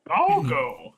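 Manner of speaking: put-on high old hermit voice; squeaky rising old master call; Master Roshi impression. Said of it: "be your Master Yoshi".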